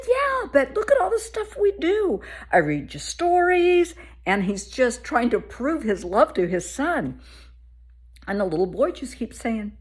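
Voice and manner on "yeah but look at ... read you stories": higher, louder coaxing dad; pleading dad. Retold or imitated. Imitating the dad pleading with his son. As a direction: coax the boy, buddy-style.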